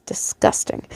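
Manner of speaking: whispered disgust under the breath; a hushed ugh of disgust. Real, not acted. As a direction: mutter it in revulsion, voiceless. Reader's disgusted aside.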